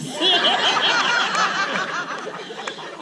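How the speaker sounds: loud, high, drawn-out laughter; long high laugh. Real, not acted. Teacher laughing hard after thanking Z for coming to class.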